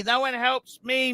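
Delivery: usual speaking voice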